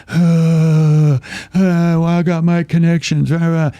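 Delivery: loud, high whine of grumbling passengers; drawn-out, hammed-up griping; mock complaining. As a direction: imitate a cabin full of moaners. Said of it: "Everyone's complaining".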